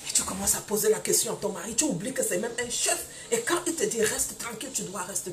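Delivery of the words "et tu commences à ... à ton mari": ordinary delivery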